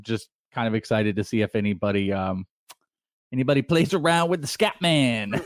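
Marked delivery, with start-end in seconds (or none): none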